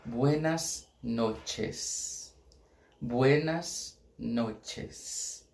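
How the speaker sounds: buenas noches drawn out very slowly; slow Spanish. Shown as a demonstration. Teacher models buenas noches slowly. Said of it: "A gente vai ter que expressão?".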